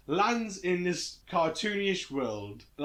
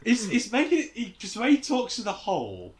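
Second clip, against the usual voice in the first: ordinary delivery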